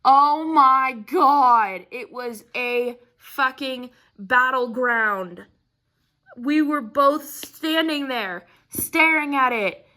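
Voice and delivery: ordinary talk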